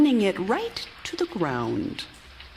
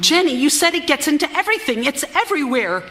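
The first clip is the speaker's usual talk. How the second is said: higher, louder and fast, words tumbling; agitated outburst; 8 semitones higher, 5 dB louder, much faster than usual. Acted. Wife snapping at Jenny about the room, agitated and fast. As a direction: burst out at her, agitated.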